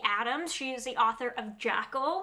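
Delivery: ordinary talk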